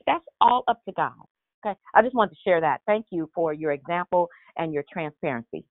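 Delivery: normal talking voice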